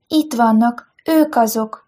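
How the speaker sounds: plain reading voice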